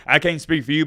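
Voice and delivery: normal talking voice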